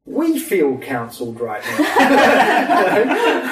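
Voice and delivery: slow, high, loud and laughing; laughing quote. Retold or imitated. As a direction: spell it out in amused disbelief. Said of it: "They're like".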